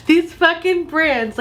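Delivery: high, slow mock Sopranos accent; hammed-up Sopranos gripe; Sopranos-style accent. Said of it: "I've been watching a lot of Sopranos".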